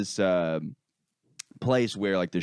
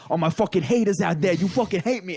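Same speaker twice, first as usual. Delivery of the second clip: higher, louder mock taunt to the crowd; hammed-up defiance, pitched up; defiant freestyler; 8 semitones higher, 4 dB louder than usual. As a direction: imitate a cocky rapper calling out his haters. Retold heckled freestyler taunting the crowd.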